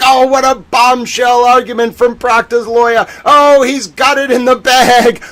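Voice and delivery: high, loud mocking voice; mocking fans. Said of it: "They were like".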